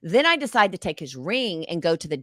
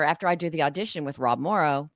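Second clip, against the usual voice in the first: ordinary talk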